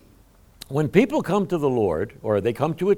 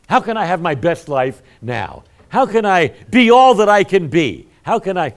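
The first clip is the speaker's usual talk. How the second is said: lightly mocking seeker crowd, louder and higher; 7 semitones higher, 8 dB louder than usual. Pastor mocking what crowds want to hear, hammed-up. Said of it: "they wanna hear".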